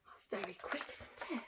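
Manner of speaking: gasp, then hushed whisper; dramatic whisper. Acted. Teacher hushing pupils over the fast trap, whispered. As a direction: whisper it like a secret to the class. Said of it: "gasps whisper".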